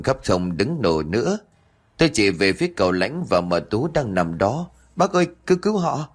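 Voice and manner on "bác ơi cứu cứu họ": high, fast, breathless cry for help; panicked plea. Acted. Girl begging the uncle to save the stabbed couple, panicked and fast. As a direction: beg frantically before fainting.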